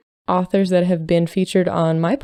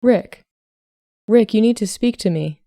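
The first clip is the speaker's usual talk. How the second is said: higher, louder urgent call; raised-voice worried mother on the phone; more loudly; 4 semitones higher, 3 dB louder than usual. A narrator's reading. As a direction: press him firmly to answer. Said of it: "I say more loudly".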